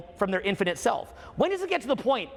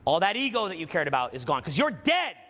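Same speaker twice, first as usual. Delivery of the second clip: guided meditation; 4 semitones lower than usual. Acted. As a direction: lead them gently into it.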